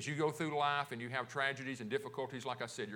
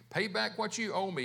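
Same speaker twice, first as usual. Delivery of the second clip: higher, louder reading of a demand; 6 semitones higher, 4 dB louder than usual. A narrator's reading. Servant to the man who owes him, demanding. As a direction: read it as a raised, insistent demand. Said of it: "he demanded".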